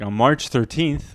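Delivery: normal talking voice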